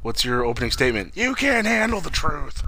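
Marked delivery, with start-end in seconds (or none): 1.2-2.6 high, drawn-out dramatic proclamation; mock courtroom outburst. Jokey opening statement to a friend, mock courtroom outburst. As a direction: deliver it like a hammy witness, slowly and high.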